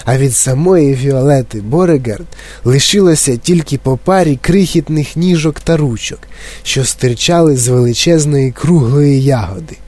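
usual speaking voice

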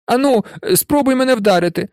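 high-pitched, daring challenge; boy digging his heels in; stubborn defiance. A narrator's reading. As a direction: dare him to try it. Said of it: "затявся Невіл".

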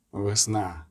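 низько, ледь чутно; промов стишено й низько, майже про себе; тихо. A narrator's reading. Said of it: "сказав тихо". (тихий низький голос)

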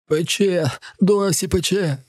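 high, agonised whine; pained cry. A narrator's reading. Man's sprayed eyes are burning. (болісний високий зойк)

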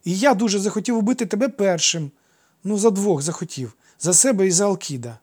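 put-on high, loud boyish insistence; excited high-voiced declaration; blurting out. A narrator's reading. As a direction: pour it out triumphantly like a child. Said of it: "випалив він".

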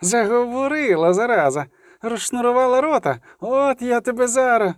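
high-pitched, jeering menace; angry threat. A narrator's reading. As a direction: taunt and threaten the captive in rising temper. Tormentor threatens the beaten girl.

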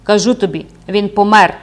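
loud shout of fury and despair; raging, despairing shout. A narrator's reading. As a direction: shout it at her in desperate rage. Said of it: "хриплим від люті й розпачу голосом крикнув". (лютий і розпачливий крик)

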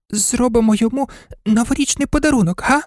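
high, quick little dog voice; toy dog proposing. Acted. Toy dog suggesting a gift to the others, high and quick. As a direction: float the idea eagerly in a high voice.

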